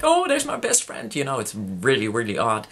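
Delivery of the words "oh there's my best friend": high-pitched, thrilled quote of herself; much higher, gushing mock greeting; delighted greeting. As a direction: act out spotting a best friend. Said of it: "I went like".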